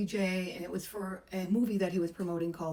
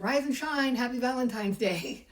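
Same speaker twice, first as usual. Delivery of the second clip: bright, louder sing-song greeting, pitched up; cheery wake-up call; 5 semitones higher, 5 dB louder than usual. Retold or imitated. Masseuse cheerfully waking the star on Valentine's morning, bright call. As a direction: wake him up with a bouncy hello.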